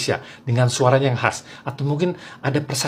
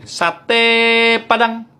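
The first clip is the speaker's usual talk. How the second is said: "Sate" stretched high and long, "Padang" punched at the end; Padang satay cry; 8 semitones higher, 4 dB louder, much slower than usual. Shown as a demonstration. Padang satay vendor calling, long "Sate", stressed final "Padang". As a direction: hold "Sate", then hit "Padang" short. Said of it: "sate Padang harus gini".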